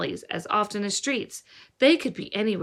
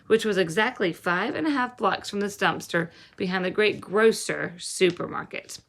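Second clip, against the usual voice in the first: ordinary delivery, nothing marked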